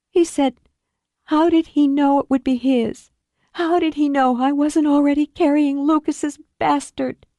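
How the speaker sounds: high, tear-choked retelling; repeating his cruel words through tears; wounded disbelief; Julie, tearful. Acted.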